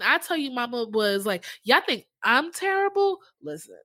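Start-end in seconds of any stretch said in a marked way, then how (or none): 1.6-3.2 sassy grandma. Retold or imitated. Voicing mama's sass.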